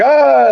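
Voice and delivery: a sudden high mock yelp of alarm; startled yelp. Retelling being grabbed by immigration officers, a high startled yelp. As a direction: yelp like you've just been grabbed. Grabbed and pulled over bar.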